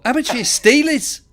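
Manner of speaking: hammed-up Liverpudlian lad; attempted Liverpool accent. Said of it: "I'm gonna try the accent".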